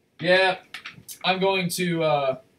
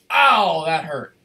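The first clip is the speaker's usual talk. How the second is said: sharp ow of pain; 2 semitones higher, 7 dB louder, slower than usual. Real, not acted. Jacket too tight, hurts.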